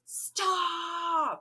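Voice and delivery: high, sharp shout of stop; a mock heroic cry to stop everyone; commanding yell. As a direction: halt the whole crowd at once. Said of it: "somebody yells".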